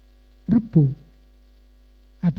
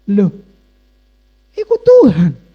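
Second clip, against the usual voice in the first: amazed exclamation; 9 dB louder than usual. Retold or imitated. Preacher reliving his win before the crowd, acted amazed cry. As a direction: cry out in sudden astonished recognition. Reacting to winning the prize.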